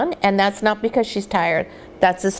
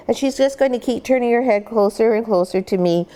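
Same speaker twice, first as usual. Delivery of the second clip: ordinary delivery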